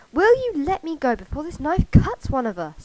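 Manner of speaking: high, loud squawked protest; indignant squawk. A narrator's reading. Woman being spun round protests, high loud squawk. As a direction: squawk it out in alarm. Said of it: "Sophie squawked".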